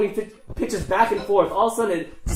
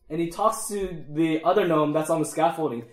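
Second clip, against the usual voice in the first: ordinary delivery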